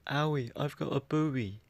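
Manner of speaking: lightly voiced hurt child, slow; a hint of a small child; kid who got hurt. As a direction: whine it a little like a hurt kid. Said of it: "something a kid would say if he gets hurt".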